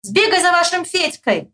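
high exasperated call; high-pitched holler; shouting. A narrator's reading. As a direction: yell an order to a silly friend. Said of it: "Я кричу этому дуралею".

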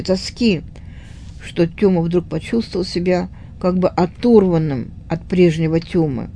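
ordinary delivery, nothing marked

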